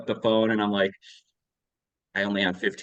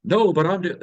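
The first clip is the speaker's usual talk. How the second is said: higher-pitched defensive protest; mock defensive; 11 semitones higher, 5 dB louder than usual. Parent of a teenager to the coach, mock defensive. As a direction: insist on it, imitating a defensive parent.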